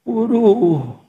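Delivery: high yelp of pain, half laughing; mock old woman's cry. Someone in the crowd crying out, high and pained. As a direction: cry out like a squashed granny. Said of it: "teriak begini".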